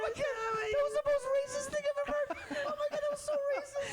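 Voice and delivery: high, fast, laughing disbelief; scandalised and cracking up; shocked outrage. Real, not acted. Shocked reaction to accent.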